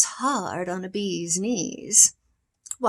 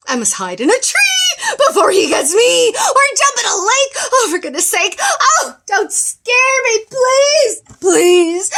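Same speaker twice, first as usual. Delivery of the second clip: high, loud frantic pleading; terrified shouting; 11 semitones higher, 11 dB louder than usual. Acted. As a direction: panic at the mouse. Elephant terrified of mouse.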